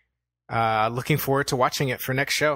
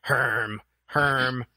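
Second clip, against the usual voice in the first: short grunted "herm", lightly acted; a hint of an unimpressed grunter; Rorschach; much slower than usual. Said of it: "I was Rorschach watching this movie".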